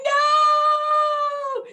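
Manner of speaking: long, very high shouted "no"; desperate scream. Retold or imitated. Mom chasing her son, screaming a long high no. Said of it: "I'm like".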